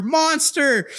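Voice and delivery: high, drawn-out mock yell; high-pitched holler, held back; imitated yell. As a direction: mimic a boy's battle cry at normal volume. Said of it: "and I hear".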